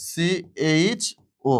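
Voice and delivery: teacher dictating CHO very slowly; drawn out slowly. Shown as a demonstration. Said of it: "এখানে আমি বসাই দিব হচ্ছে".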